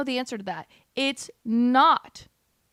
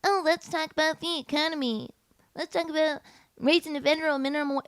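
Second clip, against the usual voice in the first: mocking voice; 5 semitones higher than usual. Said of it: "People are like".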